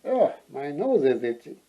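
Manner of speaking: ordinary delivery, nothing marked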